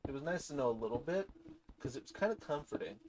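usual speaking voice

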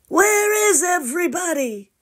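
high, loud call through the house; shouted. Acted. Grandpa calling through the empty house, high loud shout. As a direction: call out for the missing family. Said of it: "he shouted".

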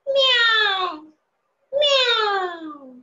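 high, drawn-out, loud meowing like a cat. Shown as a demonstration. Teacher meowing for the class to guess the animal, high and drawn-out. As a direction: stretch it into a long, loud cat's meow. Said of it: "अशी ही मांजर ओरडत असते".

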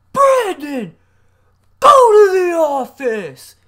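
put-on stretched-out shout sending the kid to the office; gym teacher yelling. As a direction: roar the order across the gym. Gym teacher calling out across gym.

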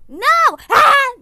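very loud, high yelp; play-acted shout. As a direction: yell it out high into a scream. Acting out a joking exchange.

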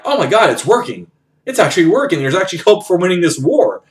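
excited hope. Retold or imitated. Soldiers see the war might be won, excited. Soldiers suddenly hoping to win.

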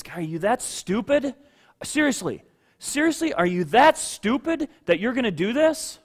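loud, high-pitched scolding in mock disbelief; acted parental outburst, pitch climbing sharply; exasperated parent scolding.

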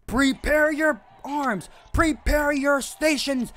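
booming ring announcer intro; arena announcer. Acted. Streamer announcing fighters to the crowd, ring announcer. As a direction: call it out like a wrestling emcee.

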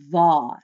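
waar said as vaar with a long A, firm and falling; teacher modelling waar; Afrikaans waar. Shown as a demonstration. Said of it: "Notice the long A sound there".

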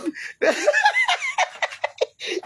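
high shrieking laugh. Real, not acted. Husband runs away.

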